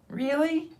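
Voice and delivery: high, small squeak of doubt; the queen not quite believing it; doubtful mouse. Acted.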